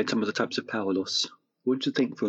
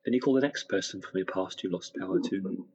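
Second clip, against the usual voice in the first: guided meditation; 4 dB quieter than usual. Acted. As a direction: gently guide them into relaxation.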